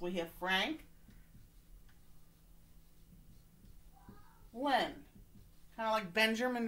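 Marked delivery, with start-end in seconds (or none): 0.0-4.9 "Frank" and "Lynn" said as separate syllables with a pause; syllable split. Shown as a demonstration. Teacher showing students the two syllables, split apart. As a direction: break it into "Frank" then "Lynn". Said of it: "now we have two syllables".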